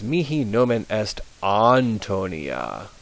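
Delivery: slow, careful Mihi nomen est Antonia; slow model reading. Shown as a demonstration. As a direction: spell out each Latin vowel. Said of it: "So it's".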